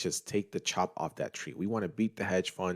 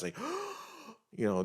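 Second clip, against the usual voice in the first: imitated gasp; 5 dB quieter, faster than usual. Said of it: "taking, like, deep breaths".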